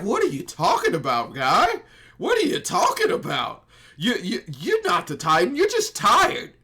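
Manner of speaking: high, loud, hammed-up disbelief tipping into laughter; comic squawk of denial; mock incredulous. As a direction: imitate someone who flatly can't believe it. Eren's retold disbelief, hammed up.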